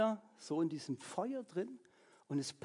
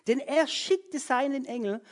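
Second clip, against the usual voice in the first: proclaiming king; 5 semitones higher, 3 dB louder, slower than usual. A narrator's reading. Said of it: "Da rief Nebukadnezar".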